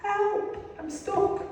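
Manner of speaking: high, thin cry of a man stuck in a pit; calling for help. Acted. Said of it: "the addict called".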